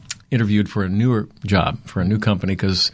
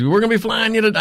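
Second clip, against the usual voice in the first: put-on high-pitched Southern accent; eager Southern boss, mimicked high; Charlie; 12 semitones higher than usual. Southern boss lays out travel plans, imitated accent. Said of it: "he's real Southern accent".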